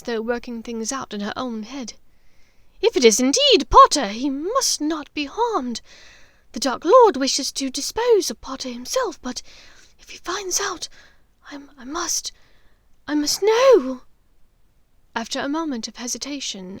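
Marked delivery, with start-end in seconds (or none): 2.8-5.8 loud then slowing, deliberate; intense witch. Acted. Bellatrix thinking aloud. 6.5-12.3 frightened witch whispering; fearful whisper. Acted. 13.1-14.0 loud, high demand; desperate insistence. Acted. Bellatrix insisting.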